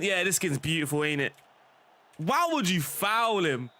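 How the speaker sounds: ordinary delivery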